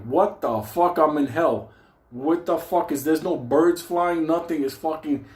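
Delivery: high-pitched, incredulous outburst; retold shock. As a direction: reel from it in loud disbelief. Acts out shock at the heat.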